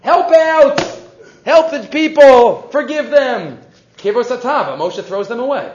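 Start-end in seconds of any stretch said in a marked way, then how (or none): 0.0-3.6 higher, louder desperate plea, drawn out; loud begging. Retold or imitated. Teacher voicing Moshe begging God to save the people, loud pleading. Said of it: "Moshe's please begging".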